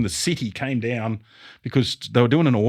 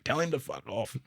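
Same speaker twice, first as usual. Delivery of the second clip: mock dismissive mutter; 13 dB quieter than usual. Leading hand brushing off the foreman, muttered softly. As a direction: toss it away under your breath, lightly imitating. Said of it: "He'd be like".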